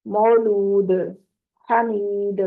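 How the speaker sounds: usual speaking voice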